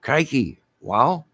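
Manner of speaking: lifted, wowed interjection; exclamation of wow. Shown as a demonstration. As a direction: show how you'd exclaim in amazement. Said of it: "Oh, it's like, wow".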